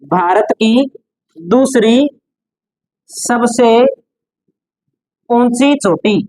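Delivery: word groups with long pauses between; dictation. Shown as a demonstration. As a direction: dictate for note-taking, phrase by phrase. Dictating the note to students. (श्रुतलेख की तरह रुक-रुक कर)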